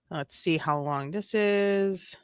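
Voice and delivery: ordinary talk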